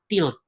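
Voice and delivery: "tilt" said with a French vowel; French pronunciation. Shown as a demonstration. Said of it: "kalau dalam bahasa Prancis".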